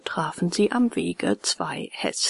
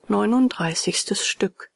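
ordinary delivery

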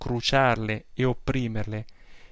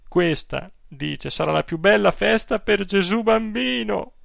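put-on higher, fretful old woman's voice, scandalized; an aggrieved pious aunt pouring out her complaints; indignant old aunt; 5 semitones higher than usual. Old aunt telling her nephew about the priest, scandalized.

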